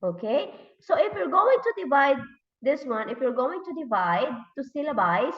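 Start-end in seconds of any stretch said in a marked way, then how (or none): none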